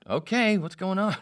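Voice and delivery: high, loud, startled questioning; taken-aback reply. Retold or imitated. Producer answers the angry man on the intercom, taken aback. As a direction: react to someone else's outburst, voice shooting up.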